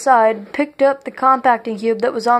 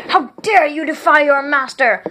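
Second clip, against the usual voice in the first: outraged shout; 4 semitones higher than usual. A narrator's reading. King shouting at Tenebris who defies him. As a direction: shout down at the rebel servant. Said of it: "Puramalum shouted".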